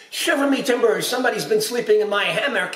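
high fast bellowing pirate. Acted. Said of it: "he bellowed".

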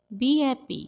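lifted and brighter repeat; cheerful repeat. Shown as a demonstration. Teacher repeats the phrase cheerfully for learners. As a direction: give it a cheerful lift.

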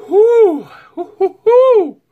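high loud hoo hoo hoo from the heat; pained hooting. Real, not acted. Vlogger hooting at the spicy burn, high and loud. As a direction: hoot out the burn. Spicy food burning mouth.

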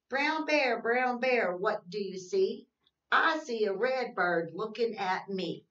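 sing-song read-aloud, slow and even; reading to children. Acted. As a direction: read the rhyme to little kids, slowly. Reads picture book to kids.

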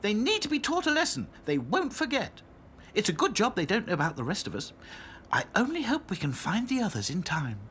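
high bright doll voice; cheerful little doll; Dotty voice. A narrator's reading.

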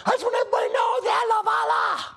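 put-on a squeaky quick shout of a sixty-year-old woman; old lady mimic. Old passenger answering the preacher's speech, high fast shout. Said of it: "She goes".

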